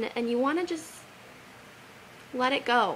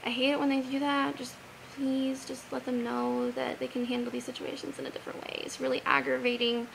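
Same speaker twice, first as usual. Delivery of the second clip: softer, a prayer said inside your head; hushed, private plea to the Lord; quiet prayer; 8 dB quieter than usual. Pretend. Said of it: "Just be like".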